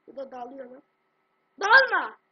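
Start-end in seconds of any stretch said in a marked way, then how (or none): 1.6-2.2 sudden sharp yell; shout. Real, not acted. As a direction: bark it at full volume. Yelling at game.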